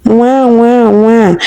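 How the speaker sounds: put-on high wailing baby cry. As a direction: wail like a hungry baby. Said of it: "He cried loud".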